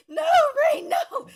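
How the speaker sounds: high, loud mock pleading; pleading friends. Classmates holding him back, high pleading. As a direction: beg him to stop, laughing.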